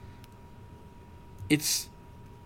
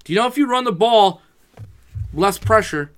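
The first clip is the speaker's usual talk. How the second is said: raised, higher voice pleading with the coaches; loud insistent plea to run the ball; exasperated raised voice; retold plea; 7 semitones higher, 6 dB louder than usual. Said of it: "and I go".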